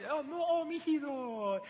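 very high, cooing mock sympathy. David teases the drowsy guard Abner, mocking coo. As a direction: coo at him like a baby. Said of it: "he's just mocking him".

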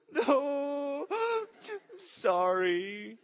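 put-on high, sobbing little boy with gasps; crying child. Son forced to apologise to his father, high sobbing child. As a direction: gasp and wail like a toddler caving in.

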